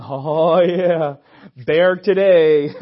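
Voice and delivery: loud, slow, hammed-up eagerness; a loud parody of a brawler; mock eager. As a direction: play the reckless hothead who can't wait to fight.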